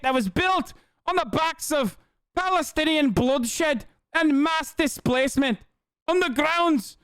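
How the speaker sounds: high-pitched Scottish parody voice; mock her in a put-on Scottish accent, pitched high; Scottish caricature, high and declaiming; Eve Fartlow impression, Scottish accent. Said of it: "my regular Eve Fartlow impression here".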